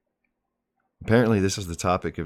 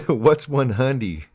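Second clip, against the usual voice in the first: laughing; 6 semitones higher than usual. Real, not acted. Host reading viewer's question about his shorthand, laughing. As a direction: crack up while reading the question. Reads chat question, cracking up.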